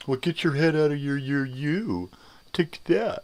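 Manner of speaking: high, loud sneering put-on voice; mocking smart-ass. Mocking a commenter's botched comeback, in a high, loud gloating voice. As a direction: gloat it like a troll who flubbed. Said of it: "Some really smart ones".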